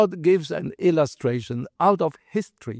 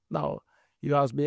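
ordinary talk, nothing marked

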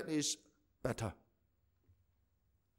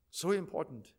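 quiet echo; 6 semitones lower, 7 dB quieter than usual. Acted. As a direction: lower and softer, letting it sink in. Preacher repeats phrase, dropping voice.

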